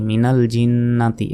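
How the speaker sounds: জিন্নাতি with held n and sounded ti; non-stop form. Shown as a demonstration. Teacher repeating the reading without a stop, জিন্নাতি ending in ti. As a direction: draw out the n of জিন্নাতি, finish on ti. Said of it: "এভাবে".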